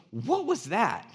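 high, loud stunned question; astonished. Retold or imitated. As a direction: act out bewildered amazement. Dad astonished, retold.

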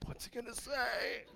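high, very soft wondering voice; hushed anticipation. Retold or imitated. Silent class staring at the sub, hushed anticipation. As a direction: wonder it under your breath, curious. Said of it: "this look of anticipation and curiosity".

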